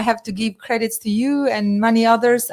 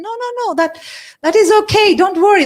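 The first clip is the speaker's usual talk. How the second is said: much higher, louder, breezy no-no-no; dismissive reassuring accountant; 11 semitones higher, 5 dB louder, faster than usual. Retold or imitated. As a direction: wave away the worry airily. Retold accountant dismissing the worry.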